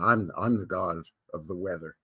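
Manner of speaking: "I'm, I'm the god of the weather" low and ominous, like a deity; deep god voice. Shown as a demonstration. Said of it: "No, no, no, hold on".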